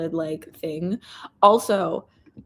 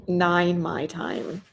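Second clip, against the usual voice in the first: ordinary talk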